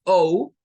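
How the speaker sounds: O said as a long gliding "oh-oo"; long vowel O. Shown as a demonstration. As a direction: give O its full long sound. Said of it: "es el sonido largo".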